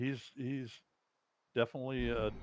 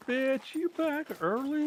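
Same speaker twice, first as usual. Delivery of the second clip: very high, slow put-on cat voice; drawn-out falsetto, unimpressed by the owner; snooty cat; 4 dB louder, slower than usual. Voicing a cat as owner comes home, falsetto disdain. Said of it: "cats are like".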